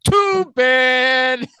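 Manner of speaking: loud, high sarcastic too bad; mock pity. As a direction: sneer it with fake pity. Mocking daughter's hopes.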